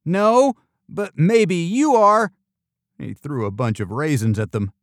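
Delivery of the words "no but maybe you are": high, loud comeback, slowing at the end; raised, emphatic kid's retort. A narrator's reading. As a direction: throw the tease back, drawn out. Retort to a friend's teasing.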